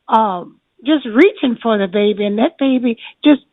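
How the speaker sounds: ordinary talk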